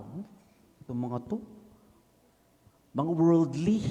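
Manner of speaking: mocking his own proud scorn; smug judgment. Proud newcomer judging confessing group mates. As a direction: sniff at them as worldly. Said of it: "Eh, mayabang ako".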